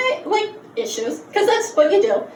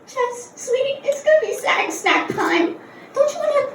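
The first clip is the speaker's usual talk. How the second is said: put-on higher, softer babysitter voice; patient, syrupy childminder; coaxing babysitter; 7 semitones higher, 3 dB quieter than usual. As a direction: sweetly coax a small child, pitched up.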